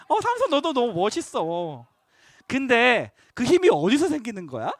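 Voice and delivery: a hint of cute pleading. Delilah sweetly flattering Samson, mildly cute. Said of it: "막 이렇게 귀엽게".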